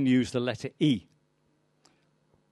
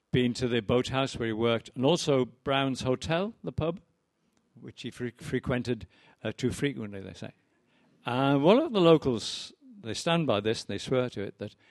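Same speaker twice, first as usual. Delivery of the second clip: ordinary talk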